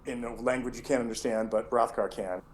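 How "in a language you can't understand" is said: ordinary delivery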